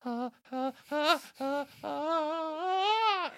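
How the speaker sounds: high panicked whimpers building to a wail; hammed-up rising squeals of dread; Pearl. Pearl's reaction in Say Uncle, mounting panicked wail. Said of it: "her reaction in, uh, Say Uncle".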